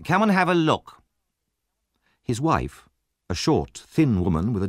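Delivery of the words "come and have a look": calling out. A narrator's reading. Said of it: "he called".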